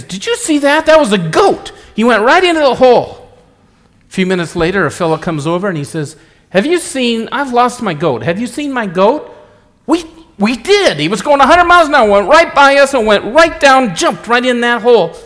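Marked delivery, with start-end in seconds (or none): 0.0-3.3 much higher, louder, amazed; excited high voice. Acted. Man excited about flying goat. 6.5-9.3 much higher, searching; anxious high voice. Acted. Goat owner asks around. 9.9-15.2 much higher, louder, fast tumbling words; excited rush. Acted. Man reports goat excitedly.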